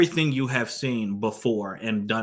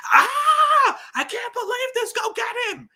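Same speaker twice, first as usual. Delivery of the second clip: hammed-up shriek, far higher, loud start; mock screaming; 5 dB louder than usual. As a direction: screech it like an over-the-top actress. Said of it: "starts screaming at the top of her lungs".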